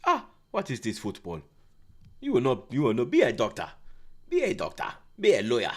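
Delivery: lightly done scolding African dad; stern parent, a hint of an African accent; mock African parent lecturing his son. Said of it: "That's what they'll say".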